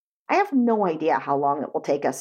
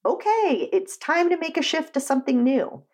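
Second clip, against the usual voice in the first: lightly voiced higher self, pitched up; 7 semitones higher than usual. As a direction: lift the pitch a little theatrically, as the higher self speaking. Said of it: "Our higher self may be saying".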